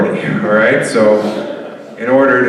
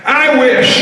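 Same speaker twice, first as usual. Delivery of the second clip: laughing; 10 semitones higher, slower than usual. Pretend. Comedian's punchline answer, laughing.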